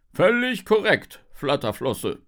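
loud, booming shark sergeant; pompous police officer. A narrator's reading. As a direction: confirm loudly with official importance. Shark officer confirms to his partner. (lauter, wichtiger Wachtmeister)

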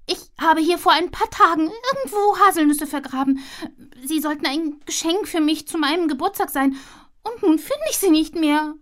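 high squirrel voice explaining; worried little squirrel; fretful squirrel. Acted. Squirrel tells Giesbert her birthday nuts are gone, high voice.

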